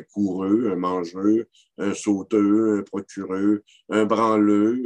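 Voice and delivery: -eur endings said "-eux": "coureux, mangeux"; silent final R. Shown as a demonstration. Lecturer listing examples, "coureux" without final r. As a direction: end "sauteux", "procureux" on an open eu, no r. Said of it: "devient muet dans les non-verbaux".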